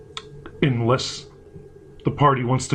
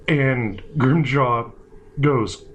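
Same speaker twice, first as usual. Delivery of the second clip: usual speaking voice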